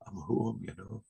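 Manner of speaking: very soft retold awe; softly acted homecoming, trailing to almost nothing; hushed realization. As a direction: say it quietly, as if overcome on arriving. Tearful homecoming in retold vision.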